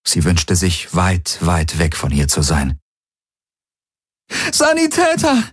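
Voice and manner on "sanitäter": high desperate call for a medic. Acted. Kirai calling for a medic amid the dead, high desperate call. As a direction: cry out across the battlefield. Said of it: "rief sie den wenigen Golvonen zu".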